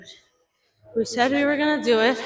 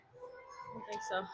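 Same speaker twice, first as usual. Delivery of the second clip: whisper; 2 semitones lower, 18 dB quieter, slower than usual. Real, not acted. Unvoiced aside.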